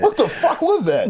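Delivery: very high, baffled squeal; incredulous outburst. Real, not acted. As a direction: blurt it out in high-pitched disbelief. Baffled reaction to failed reading.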